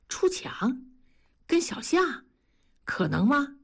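shocked questions climbing up high; astonished. A narrator's reading. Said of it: "同志惊讶地说道".

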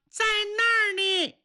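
put-on small child. A narrator's reading. Small child answers the searching men, high childlike voice. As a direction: chirp it out like a small kid. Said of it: "有个小孩说".

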